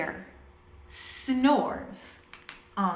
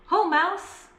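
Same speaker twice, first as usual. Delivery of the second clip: hare greeting; 8 semitones higher, 4 dB louder than usual. A narrator's reading. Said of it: "says Hare".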